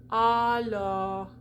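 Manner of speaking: Alors with lips pushed out on the open o; Alors, dark open o with lots of lips; rounded open o. Shown as a demonstration. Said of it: "Lots of lips".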